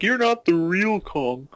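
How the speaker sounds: high, slow sneering taunt; mocking taunt. Villain at the start taunting Kong, high sneer. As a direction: drag it out, mocking him. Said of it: "the fucking bitch ... was like".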